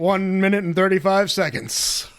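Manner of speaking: mock announcer challenge. Friend jokes about a timed challenge, loud and hyped. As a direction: hype it up like a host.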